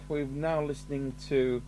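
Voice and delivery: normal talking voice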